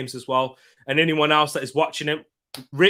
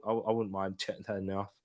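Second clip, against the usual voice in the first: normal talking voice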